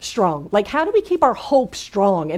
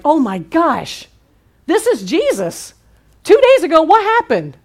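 amazed realization; 6 semitones higher, 4 dB louder than usual. Retold or imitated. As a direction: act out the disciples' stunned discovery. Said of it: "They're like".

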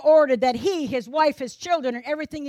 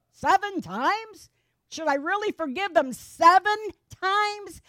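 Peter balking, stress on seven; incredulous Peter; 6 semitones higher than usual. Acted. Peter doubting Jesus' forgiveness count.

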